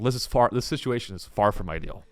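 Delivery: soft, low deadpan understatement; mock understatement. Visitor at Auschwitz, soft deadpan understatement. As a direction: say it blandly, as if nothing is wrong.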